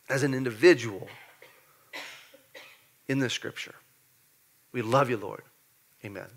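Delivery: soft, hushed prayer; quiet prayer. Real, not acted. Pastor praying over the congregation, soft and hushed. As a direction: pray low and intimate over the church. Prays softly.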